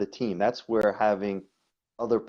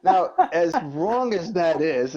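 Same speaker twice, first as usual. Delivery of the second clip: normal talking voice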